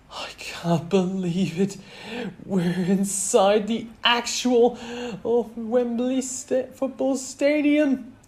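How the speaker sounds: high, slow, awestruck excitement; excited. A narrator's reading. Boy amazed inside Wembley stadium, high and excited. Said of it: "he said in excitement".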